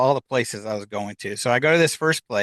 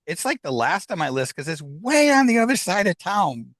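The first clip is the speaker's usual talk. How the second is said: higher-pitched retold protest to an unseen dad; talking out loud to herself; 7 semitones higher than usual. Daughter telling dad's voice she's heading there, talking aloud to herself. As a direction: argue back into thin air, pitch jumping up. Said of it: "I'm talking out loud to myself".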